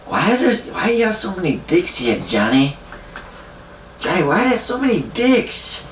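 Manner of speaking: high-pitched drunken protest, imitated; drunk buddy's complaint. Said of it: "he comes in the door and he's like".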